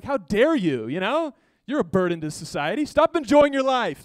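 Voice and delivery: loud, indignant scolding, hammed up; mock outrage. Preacher imitating people offended by someone not busy, mock outrage. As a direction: build to a high, loud mock rebuke.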